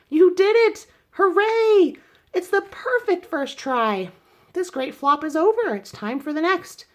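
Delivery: shouted high and loud with delight; overjoyed cheer. A narrator's reading. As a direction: cheer her on like she just won. Great-aunt cheers Rosie's crash.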